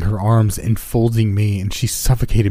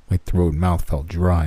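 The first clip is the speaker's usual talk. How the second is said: usual speaking voice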